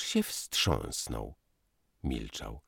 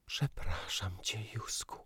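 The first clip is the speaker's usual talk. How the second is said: whispered, much softer apology; quiet apology; 3 semitones higher, 9 dB quieter than usual. A narrator's reading. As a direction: whisper it to him. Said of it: "powiedziała cicho".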